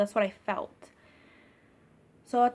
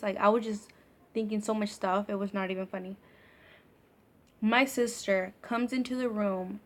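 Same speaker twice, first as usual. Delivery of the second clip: ordinary talk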